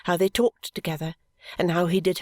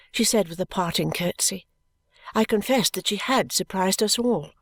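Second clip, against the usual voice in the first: usual speaking voice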